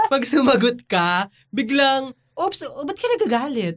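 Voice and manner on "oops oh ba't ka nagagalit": higher, sing-song fake innocence; mock innocent coworker. Coworker feigning innocence after provoking her, mock sweet. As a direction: play dumb, all sweetness.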